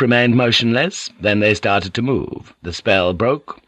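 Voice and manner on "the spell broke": ordinary delivery, nothing marked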